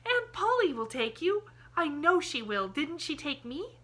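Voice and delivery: put-on a girl thrilled by her plan; excited cry. A narrator's reading. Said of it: "she cried".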